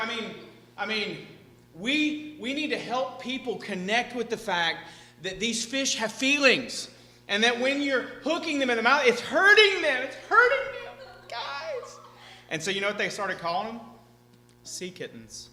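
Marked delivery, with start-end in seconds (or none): 0.0-12.0 high, rising mock earnest plea; mocking activist voice. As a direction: whine it like a preachy activist. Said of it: "And they're like".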